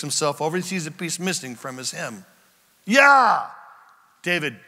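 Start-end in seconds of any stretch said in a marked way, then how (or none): 2.8-3.5 high, very loud answer; acted shout back. Saul answering David from below, shouting. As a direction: holler the reply across a distance.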